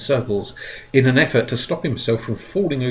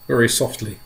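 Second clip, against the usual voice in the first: usual speaking voice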